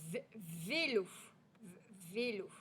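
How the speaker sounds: long V, then VÉLO, repeated; isolated initial v. Shown as a demonstration. Teacher shows the first sound, "v-, vélo" segmented. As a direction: hold "v-" before saying "vélo". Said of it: "C'est bien le son".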